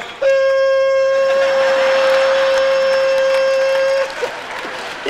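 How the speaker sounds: long, loud, very high steady hum from the mouth; comic reveal of the tone, a sustained high hum; high-pitched hum. Shown as a demonstration. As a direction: make the mystery tone with your mouth. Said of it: "so I started to go".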